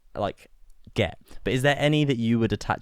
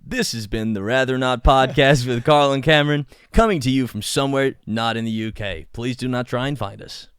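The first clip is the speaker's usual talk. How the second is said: louder American announcer sign-off; American accent; 3 semitones higher, 6 dB louder than usual. Acted. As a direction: sign off the show in put-on American. Mock podcast sign-off in American accent.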